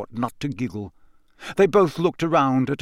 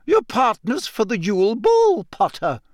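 higher, stern and cold; coldly; 9 semitones higher, 3 dB louder than usual. A narrator's reading. McGonagall answers Harry's question, coldly. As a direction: talk down to him coolly. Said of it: "she said coldly".

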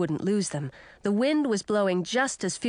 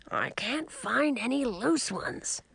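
muffled, slow, higher voice; 5 semitones higher, 3 dB quieter, slower than usual. A narrator's reading. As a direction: speak as if under a blanket. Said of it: "he said in a muffled voice".

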